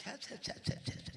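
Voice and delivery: soft unvoiced disgusted tsk-tsk; whispered shame; whispered tut-tutting. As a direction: whisper the mother's shocked clicks. Mother shamed, covering eyes.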